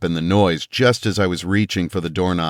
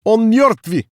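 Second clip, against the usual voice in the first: very high, loud panicked Russian yell; hysterical yell in Russian; 6 dB louder than usual. A narrator's reading. Soldier screaming that a man is dead, hysterical. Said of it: "men yelling in hysterical Russian".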